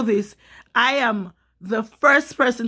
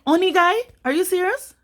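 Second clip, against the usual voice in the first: incredulous; 8 semitones higher, faster than usual. Retold or imitated. Hearing the cop coddle her attacker, outraged disbelief. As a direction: throw the phrase back in disbelief.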